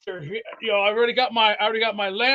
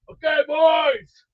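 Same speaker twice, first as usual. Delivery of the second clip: slow, loud play call; 5 semitones higher, 3 dB louder, slower than usual. Pretend. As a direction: bark it out like a quarterback. Calling a football play.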